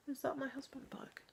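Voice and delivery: hushed whispered question. Real, not acted. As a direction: whisper while listening out. Hears someone arrive outside.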